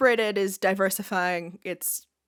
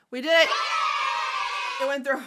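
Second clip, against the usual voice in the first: triumphant cheer; 4 semitones higher, much faster than usual. Real, not acted. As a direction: burst out cheering. Celebrating getting past the censor.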